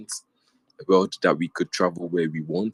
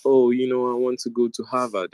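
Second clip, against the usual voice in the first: mocking classmate; 5 semitones higher, 3 dB louder than usual. Classmate quoted saying Harvard dream.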